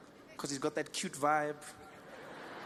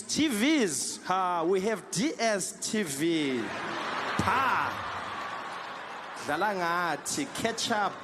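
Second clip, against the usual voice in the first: proud South African; 3 dB louder, much slower than usual. Retold or imitated. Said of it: "do you guys have T Vs".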